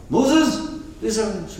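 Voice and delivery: high, loud call to Moses; panicked plea. Acted. Frightened guy running to Moses for help, high and loud. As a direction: burst in crying out for Moses, high.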